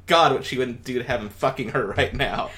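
can barely get the line out for laughing; laughing while reading. Real, not acted. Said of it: "I know I am".

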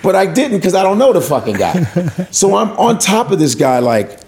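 normal talking voice

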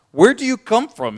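high, loud, demanding Pilate. Acted. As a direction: press Jesus hard for an answer.

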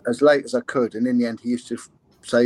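ordinary talk, nothing marked

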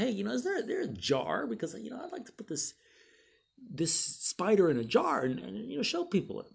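high, breezy put-on innocence; fake casual. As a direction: act innocent to set up the prank. Feigning casual talk before prank.

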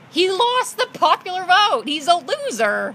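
loud, high-pitched insistence; heated outburst. Real, not acted. Co-host heckling about the election, heated and loud. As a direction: shout down a rival argument, high and emphatic.

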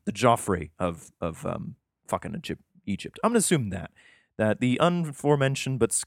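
usual speaking voice

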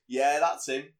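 loud, lightly acted agreement; backing mate. Imitating a mate backing the false accusation, loud mock agreement. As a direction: chime in loudly as the mate. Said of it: "his mate just goes".

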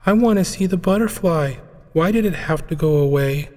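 put-on high, tearful little girl voice; a small child whining through tears; crying little girl. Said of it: "Madison began to cry".

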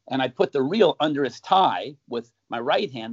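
ordinary talk

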